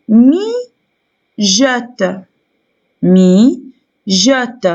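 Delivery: "Mijote" slowly, as mi, pause, jote; split into syllables. Shown as a demonstration. Teacher showing pupils two syllables, "Mijote" split as mi, jote. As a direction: pull "Mijote" apart into two long beats. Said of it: "On peut le découper en deux".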